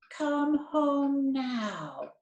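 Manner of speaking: slow, drawn-out mock call from mom; mild mock bellow. Retelling mom calling the kids home, a mild mock bellow. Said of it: "my mom bellowed".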